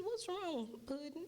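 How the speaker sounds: high-pitched imitation of a kindly old lady; little old lady. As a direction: lift it high, an old lady checking on someone. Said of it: "little old lady came down".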